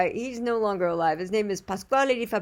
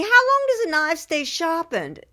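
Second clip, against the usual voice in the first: higher, louder British imitation; British accent; 10 semitones higher, 6 dB louder than usual. Knife sharpener quoting his customers' question, British accent. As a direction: put on an English accent, pitched up. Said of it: "he's got a really great accent".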